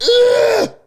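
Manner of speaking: sudden high, loud strangled grunt; loud tic grunt. Retold or imitated. Imitating his own tic at home, loud grunt. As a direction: let a loud involuntary grunt burst out.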